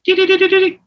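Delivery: high beeping tone imitation; high sung beeps like a touch-tone pad; imitated dial tones. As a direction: mimic the tone dialer beeps, way up high. Imitating phone tone sound.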